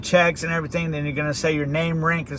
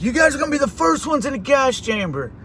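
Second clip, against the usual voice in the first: high, announcing the order to recruits; drill sergeant; 6 semitones higher than usual. Retold or imitated. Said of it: "And he was like".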